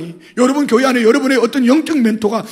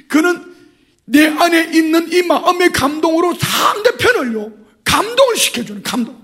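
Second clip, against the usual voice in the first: rising preaching; 8 semitones higher than usual. Real, not acted. Preacher cries out about being moved by grace. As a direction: build it up into a cry.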